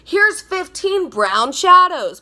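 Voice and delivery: high, loud, slow mocking sing-song; mock palette. Mocking palettes that are all browns, high and drawn out. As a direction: parody a palette proudly offering brown. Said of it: "every palette is like".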